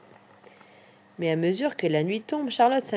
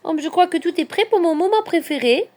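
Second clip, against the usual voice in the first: high, louder eager announcement; little hedgehog; 9 semitones higher, 5 dB louder than usual. A narrator's reading. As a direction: announce a treat in a raised character voice. Hedgehog voiced, announcing favourite moment. (petit hérisson enthousiaste, voix aiguë)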